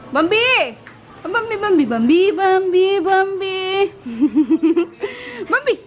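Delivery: high, gushing sing-song calls of the name. Real, not acted. Owner gushing over her dog Bambi, excited and high. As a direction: squeal the dog's name over and over.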